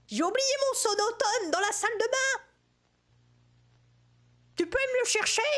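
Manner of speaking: high-pitched hard-of-hearing granny voice; put-on high granny voice; startled, apologetic granny. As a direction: play an old granny, high and fussy.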